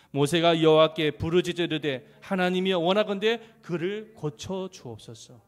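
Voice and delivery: very loud, slow congregational reading; loud, slow recited Bible verse; scripture reading aloud. Acted.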